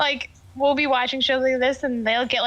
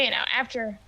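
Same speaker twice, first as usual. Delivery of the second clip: ordinary talk, nothing marked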